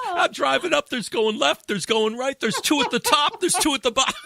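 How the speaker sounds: high, loud garbage man; retold agitated rant. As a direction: reel off the sightings in mock alarm, laughing. Acts out garbage man's alarmed report.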